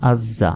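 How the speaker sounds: আজ জা as az-za, buzzing z held; doubled consonant. Shown as a demonstration. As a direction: hold the z of আজ into জা. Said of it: "তাজদিদের উচ্চারণ দুইবার হয়". (আজ জা, জ দুইবার)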